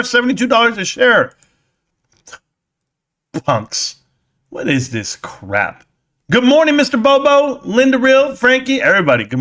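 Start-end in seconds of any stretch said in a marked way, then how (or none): 3.4-5.8 low grumble. Acted. Grumbling at chat comments.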